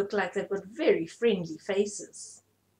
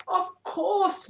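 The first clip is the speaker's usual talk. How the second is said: booming; 9 semitones higher than usual. A narrator's reading. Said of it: "boomed the elephants".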